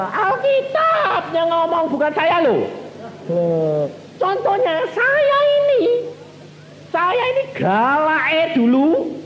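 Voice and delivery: high, loud, drawn-out preacher's cry; preaching at full force. Acted. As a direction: preach it out slowly and loudly to the congregation.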